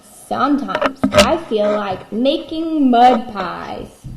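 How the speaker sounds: slow, loud sing-song book reading; reading to a toddler. Acted. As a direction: read it big and slow to kids. Reads book page.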